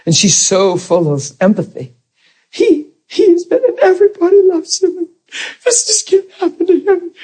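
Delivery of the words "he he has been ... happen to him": high, crying plea; retold weeping woman pleading for the doctor; tearful plea. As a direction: beg through tears for him. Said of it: "was really crying".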